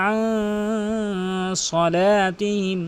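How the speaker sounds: "আন" long and nasal before slow "সলাতিহিম"; nasal ikhfa. Shown as a demonstration. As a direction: let "আন" hum in the nose. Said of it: "নাকের ভিতর নিয়ে পড়তে হবে". (নাকের ভিতর টেনে "আন সলাতিহিম")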